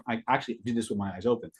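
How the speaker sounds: ordinary delivery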